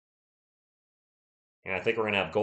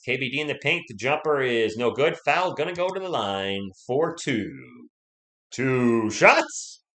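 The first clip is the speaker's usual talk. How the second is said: louder call, stretched out at the end; live play-by-play; 3 semitones higher, 5 dB louder, slower than usual. Real, not acted. Streamer calls a foul for viewers, drawn-out play-by-play. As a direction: call the foul and draw out the free throws.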